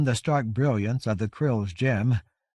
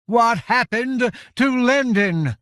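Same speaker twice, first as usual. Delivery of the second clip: anguished cry; 12 semitones higher, 6 dB louder than usual. A narrator's reading. Covenant crying out to the giants in anguish. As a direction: cry it out as if ripped open. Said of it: "released a cry that seemed to come from the marrow".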